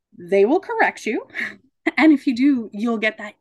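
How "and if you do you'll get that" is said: normal talking voice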